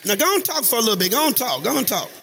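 rally the church to greet each other; preaching at full force. Real, not acted. Preacher urging congregation to greet.

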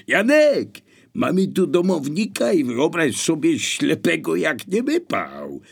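high, loud call to an accomplice; shouting. A narrator's reading. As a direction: shout it across the house to your partner. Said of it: "krzyknął".

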